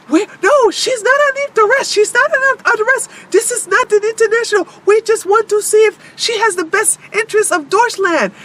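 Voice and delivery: a lightly voiced fussy official insisting she's fine; mocking German official. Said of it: "And it's like".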